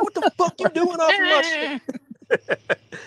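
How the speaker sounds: high, slow indignant squawk; hammed-up high-pitched confrontation; mock outrage. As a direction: imitate an outraged friend, drawn out and high. Friend confronts the intruder.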